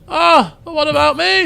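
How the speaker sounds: high, loud wail of someone left behind; Volpus's protest. Acted. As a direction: cry out high in mock dismay. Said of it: "Says Volpus".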